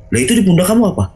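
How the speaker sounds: high-pitched imitated questioning; puzzled wife. Wife spotting sarong on his shoulder, imitated puzzled question.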